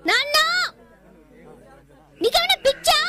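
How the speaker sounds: high, loud, incredulous cry; shocked outburst. Acted. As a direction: daughter stunned that her father begs. Daughter shocked at father begging. (ఆశ్చర్యంతో గట్టిగా అరుపు)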